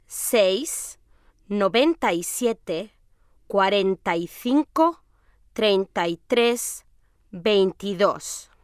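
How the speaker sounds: slow, careful number dictation. Acted. Martina slowly dictates her mobile number to Javier, careful dictation. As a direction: spell out each number slowly so it can be written.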